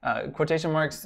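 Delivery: ordinary talk, nothing marked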